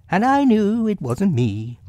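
high, emphatic reproach; lifted, scolding storybook animal voice; Rabbit. A narrator's reading. Rabbit blaming Pooh's appetite, high reproving voice.

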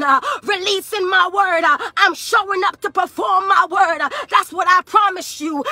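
driving, rhythmic pulpit cadence; fervent revival sermon; full-force preaching. Real, not acted. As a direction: preach it in relentless, punched phrases.

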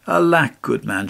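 ordinary delivery, nothing marked

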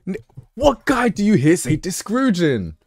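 mock outrage at a word no guy would say; incredulous outburst. Real, not acted. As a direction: throw the question back, pitch leaping up. Streamer mocking the word discouraging.

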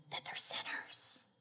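hushed unvoiced whisper. Shown as a demonstration. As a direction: whisper it so the kids can't hear. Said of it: "And I have to whisper it".

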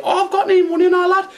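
very high mock girl voice; imitating Scruffy Lisa. Taxi driver mimicking his passenger in a very high mock voice. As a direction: squeak it out as the lass. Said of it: "She's like".

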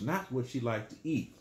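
usual speaking voice

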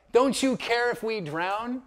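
high, slow, loud reproachful question; disciples' plea. Retold or imitated. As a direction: plead up at the teacher as a worried disciple. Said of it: "the disciples say to him".

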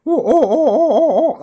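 high, loud, thin "oh, oh, oh" like a filtered voice; narrow band filtered sound. Shown as a demonstration. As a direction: imitate a narrow bandpass filter with a laughing "oh oh oh". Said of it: "So it should sound a bit sort of".

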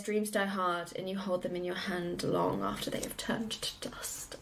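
whispery, solemn recital; hushed film quote. Retold or imitated. Fan quotes the line that makes them cry, hushed. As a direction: let it fade almost to nothing. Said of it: "when he says".

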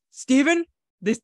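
hammed-up, high, loud sputtering reproach; mock upset scolding. Friend scolding Steven over a donut, mock upset. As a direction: splutter in mock outrage, cracking into a laugh at the end.

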